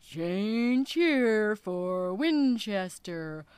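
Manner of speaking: loud, slow conductor calling out stations; train conductor call. Acted. Whale calls out the stops like a train conductor. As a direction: boom it like a station announcer.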